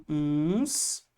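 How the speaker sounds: ordinary talk, nothing marked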